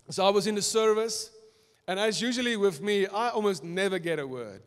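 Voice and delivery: ordinary talk, nothing marked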